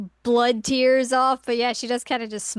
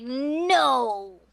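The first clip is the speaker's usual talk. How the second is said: long, drawn-out mock no; stubborn refusal; 2 semitones higher than usual. Imitating Kagome refusing to reveal her sadness, mock stubborn. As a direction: refuse with a stretched, put-on voice. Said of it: "and she's like".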